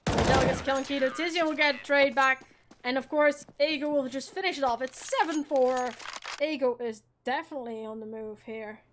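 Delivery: brisk esports caster calling the round; live play-by-play. Acted. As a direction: call the action as it happens. Casting kills live.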